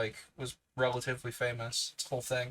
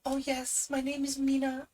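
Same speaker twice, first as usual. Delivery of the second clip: put-on much higher, light young woman's voice; Mina's character voice; 12 semitones higher than usual. Shown as a demonstration. Streamer previewing the voice saved for Mina's dialogue, high and girlish. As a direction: lift into a bright girlish Mina voice. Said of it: "I will save my ... voice for when she is actually producing dialogue".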